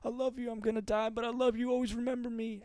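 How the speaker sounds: high soft mock deathbed plea; a lightly hammed dying father; Hank's movie farmer saying goodbye to his son, soft and high. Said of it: "He's like".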